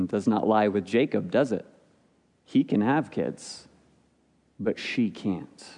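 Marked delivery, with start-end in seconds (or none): none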